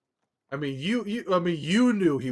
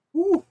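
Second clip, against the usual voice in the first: high whoop; 7 dB louder than usual. Real, not acted. Cheering his drink.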